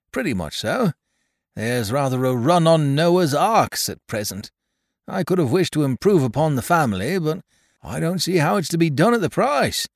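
put-on little old toymaker Caleb. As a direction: fret and ramble like a humble old toymaker. Caleb's worried rambling to carrier.

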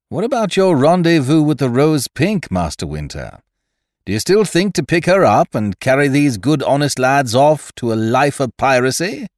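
high, loud mocking question; taunting. A narrator's reading. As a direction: needle him with glee. Ned taunts Winter.

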